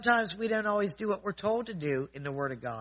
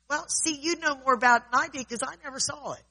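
higher and louder, mock indignant blame; indignant accusation; 7 semitones higher, 5 dB louder than usual. Upset man blaming the speaker for the lost item, indignant. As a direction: throw the blame back at him, worked up. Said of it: "He's going".

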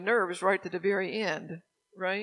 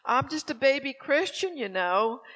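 higher-pitched imitation of a pleading friend; friend making excuses; 7 semitones higher, 4 dB louder than usual. Best friend excusing herself as a new believer, imitated plea. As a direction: plead innocence in a raised, mock-helpless voice. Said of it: "But she'd say".

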